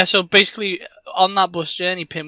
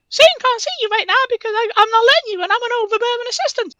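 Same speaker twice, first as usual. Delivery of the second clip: high, fast fussy assistant; shrill assistant voice; 4 dB louder, faster than usual. Acted. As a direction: play the overbearing assistant, high and quick. Assistant voiced in pitched sketch.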